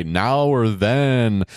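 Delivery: ordinary delivery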